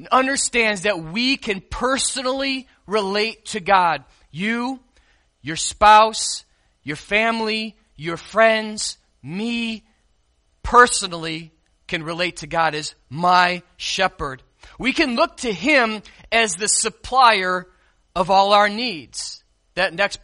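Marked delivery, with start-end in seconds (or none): none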